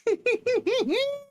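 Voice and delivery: very high squealing laugh; a helpless high giggle; squeaky laugh. Real, not acted. Streamer laughing that everything broke, high squealing laugh.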